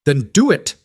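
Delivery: a loud, high, daring challenge; defiant shout. A narrator's reading. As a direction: shout it straight at the sheriff. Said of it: "Caleb shouted".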